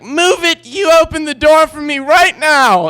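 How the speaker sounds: high, loud commanding yell; barking orders at the door; bossy demanding shout. Retold or imitated. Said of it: "she's like".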